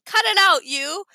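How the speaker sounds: a brief, understated angry shout. A narrator's reading. Alex yells at Elaine after her punch, shouting. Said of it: "Alex shouted".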